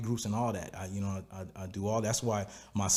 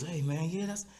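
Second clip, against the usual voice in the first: higher, light imitation of a young guy's casual feedback; quoting the son; 8 semitones higher than usual. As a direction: lightly mimic the son, pitched up. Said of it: "he goes".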